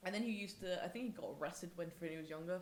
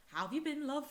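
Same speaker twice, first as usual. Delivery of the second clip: put-on higher, louder botched Mancunian accent; attempted Mancunian; 7 semitones higher, 5 dB louder than usual. Friend imitating Mancunian teacher's greeting, attempted Mancunian accent. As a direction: have a poor go at Mancunian. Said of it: "he's very Mancunian. I can't do his accent".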